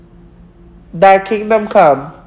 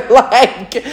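high giggle into like; amused high giggle about being done with someone; laughing; 5 semitones higher than usual. Real, not acted. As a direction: laugh it off before going on.

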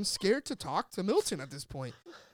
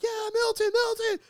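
cheering greeting; 7 dB louder than usual. Retold or imitated. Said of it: "we're like".

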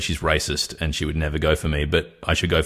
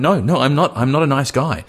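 higher, mock-desperate denial; retold protest; 8 semitones higher, 4 dB louder than usual. Retelling his plea to his date, acted-out protest. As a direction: plead your case, pitched up.

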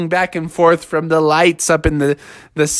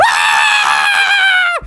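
long high-pitched mom scream; piercing drawn-out shriek; startled scream. Acted. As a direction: scream in falsetto at the shocking sight. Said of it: "she screamed when she saw".

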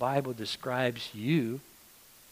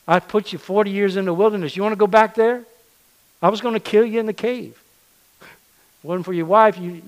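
louder and higher, hard scolding; God rebuking Moses, louder and higher; stern rebuke; 5 semitones higher, 5 dB louder than usual. Acted. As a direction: scold him like a furious master.

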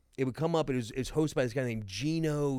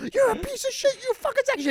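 shrieking high insults, much louder; angry caller; 8 dB louder, faster than usual. Retold or imitated. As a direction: scream abuse down the phone. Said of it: "and be like".

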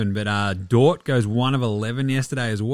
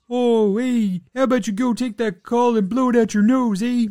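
put-on higher, louder Canadian accent losing his temper; Steve Nash blowing his lid; 12 semitones higher, 4 dB louder than usual. Podcaster imitating a Canadian star telling off a ref, Canadian accent outburst. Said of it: "A Canadian blowing his lid".

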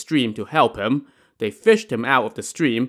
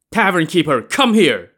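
loud, high furious bellow at the tavern keeper; angry shout, louder and higher; 5 semitones higher, 5 dB louder than usual. A narrator's reading. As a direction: slam the table and roar for service. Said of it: "he shouted angrily".

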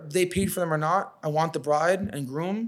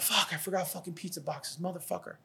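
softer grumbled curse under his breath; muttering to himself; 10 dB quieter than usual. Retold or imitated. Driver realizing he forgot boxes, muttering softly. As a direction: mutter it to yourself in the car. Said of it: "I was talking to myself".

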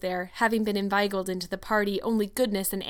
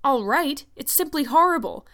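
higher, louder indignant echo; appalled outburst; 5 semitones higher, 5 dB louder than usual. A narrator's reading. Marilla condemns Anne's cake, appalled. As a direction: throw it back, appalled.

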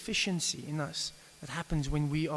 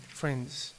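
ordinary talk, nothing marked

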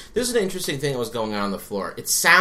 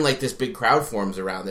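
ordinary talk

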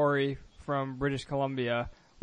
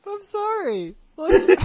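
laughing disbelief; 6 dB louder, much slower than usual. Real, not acted. Can't believe what she hears.

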